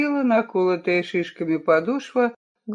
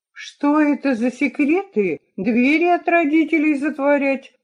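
forced confidence, higher pitched; trying to sound confident; 8 semitones higher than usual. Acted. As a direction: bluff authority over nerves. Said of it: "пытаясь говорить уверенно".

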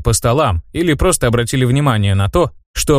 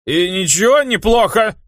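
put-on higher drunk protest; drunk indignation; 8 semitones higher than usual. A narrator's reading. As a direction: protest like a tipsy youth. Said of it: "Пьяно возмутился парень". (пьяное возмущение)

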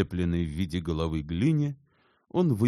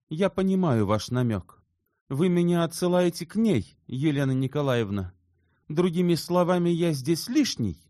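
high, loud agitated retort; nervous, stung; 12 semitones higher, 5 dB louder than usual. A narrator's reading. Shubin takes Elena's hint as a dismissal, nervously indignant. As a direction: protest hotly, nerves showing. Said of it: "начал он нервным голосом".

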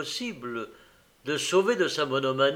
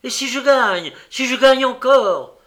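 much louder, higher shout on gagne; furious shout; 12 semitones higher, 12 dB louder than usual. Acted. Host shouts his terror of winning again, furious. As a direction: yell the fear of winning again. Raging at the suggestion.

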